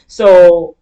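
normal talking voice

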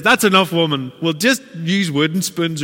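loud, stern bishop cutting her off; firm bishop. Retold or imitated. Preacher voices the bishop silencing the maid, loud and stern. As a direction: put a firm end to her fussing. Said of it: "And he says".

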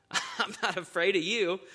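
soft, unimpressed reply with a chuckle; John calmly telling the devil no. Acted. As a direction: shrug it off softly. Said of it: "John calmly again says".

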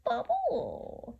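very high, slow swooping chant; scary witch's voice. Shown as a demonstration. Storyteller mixing a potion with children, scary witch chant. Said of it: "get your scary witch's voice on".

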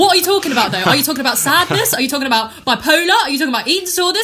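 high, loud barrage of baffled questions; mock-outraged yelling, demanding answers; exasperated shout. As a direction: shout at the screen in comic exasperation. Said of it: "I'm shouting at the screen".

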